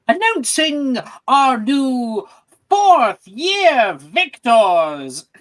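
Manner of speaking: loud, high, drawn-out ceremonial announcement; stretched-out ringing announcer call; grand announcer. Acted. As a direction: proclaim it to a cheering crowd, very slowly. Announces victors to the crowd.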